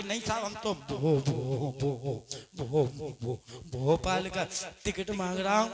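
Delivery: stammering traveller explaining himself to the station master. Retold or imitated. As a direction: plead while stuck on भो-. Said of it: "गेर इकट्ठे फंसते थे".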